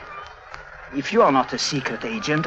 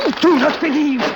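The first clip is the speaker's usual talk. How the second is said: furious shouting; 12 semitones higher than usual. Acted. Clown choking Harry for doubting him, enraged shout. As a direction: spit it out while throttling him.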